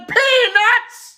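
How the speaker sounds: high shouted vendor call; a stadium vendor hollering. Retold or imitated. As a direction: yell it across the stadium. Passerby shouting at the game.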